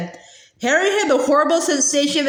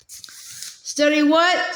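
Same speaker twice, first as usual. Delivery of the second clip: blank; 3 semitones higher, much slower than usual. Acted. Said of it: "said Harry blankly".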